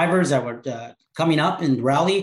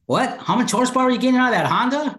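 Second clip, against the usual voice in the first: higher, faster, incredulous question; amazed young guy; 9 semitones higher, faster than usual. Retold or imitated. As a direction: fire it off like a wide-eyed kid impressed by the car. Young guys quizzing older car guys.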